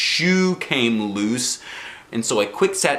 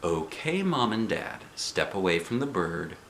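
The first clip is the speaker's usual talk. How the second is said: softer, steady and reassuring; calm guide voice; 2 semitones lower, 7 dB quieter than usual. A narrator's reading. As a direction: soothe the worried parents. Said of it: "made his voice very calmy".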